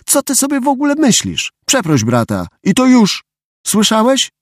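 high-pitched, indignant telling-off; scolding mother. A narrator's reading. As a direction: tell the boy off in a high, cross mum voice. Mother scolds her son. (wysoki, oburzony głos besztającej mamy)

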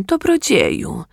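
high pleading beggar voice; whiny beggar. Acted. Beggar asks rich man.

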